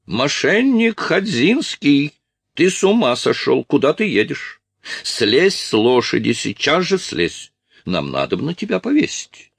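hammed-up high outrage; outraged Polish officer. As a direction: call the rogue back in a high put-on voice. Voices Strus calling after the fugitive.

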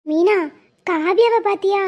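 ordinary talk, nothing marked

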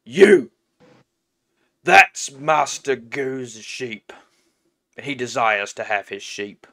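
slow, lightly voiced orc; accuse, then state the master's demand slowly; orc henchman. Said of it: "the orc speaks up and says".

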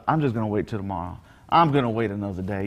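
low, quick, half-hearted mumble of an excuse; apathetic procrastinator. Retold or imitated. Preacher mimics someone putting God off till tomorrow, low and apathetic. Voices a procrastinator, contrasted with screaming.

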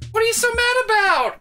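high whine, stress mad; mocking Vanessa. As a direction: whine it at him. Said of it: "mocks Vanessa".

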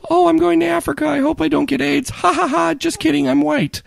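very high, squeaky mock voice; mocking tweeter voice. Quoting a tweet that got someone fired, high mocking voice. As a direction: imitate an airhead poster in falsetto.

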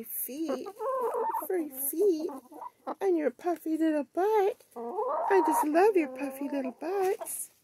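dote on the fluffy hens like babies. Real, not acted. Woman cooing over her hens' furry feet.

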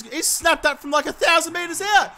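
high-pitched, astonished outburst; incredulous shouting. Real, not acted. Gamer amazed at a goal snapped from miles out, incredulous shout. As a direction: shout it in total disbelief.

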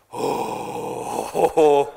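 breathy mock crowd gasp of shock. Retelling the crowd reacting to his question, gasping. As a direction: voice a whole room gasping at once.

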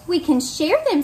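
kindly and bright; friendly ladybug. Acted. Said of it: "suggested the friendly ladybug".

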